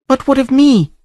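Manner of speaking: high female voice, questioning; queried. A narrator's reading. Princess asks Conan what becomes of her, high and questioning. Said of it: "she queried".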